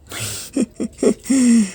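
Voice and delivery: a quick high-pitched laugh. Real, not acted.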